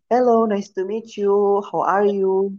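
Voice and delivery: cheesy English small talk. Retold or imitated.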